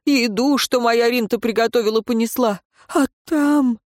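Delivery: on the edge, breaking at the end. Acted. The distraught peasant tells the advisor of the abduction, near tears. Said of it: "Надрыв в голосе Власта".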